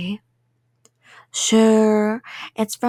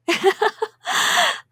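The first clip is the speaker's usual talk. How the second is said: high creepy giggle; 9 semitones higher than usual. Acted. Yandere giggle.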